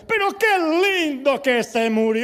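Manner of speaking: loud, high, slow exclamation; jubilant pastor. Retold or imitated. Pastor bursting into a funeral, loud jubilant cry. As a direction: belt it out jubilantly, stretching the words. Imitates founder barging into funerals.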